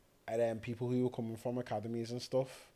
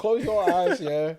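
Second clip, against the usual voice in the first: insistent; 6 semitones higher, 10 dB louder, slower than usual. Retold or imitated. Insisting in argument.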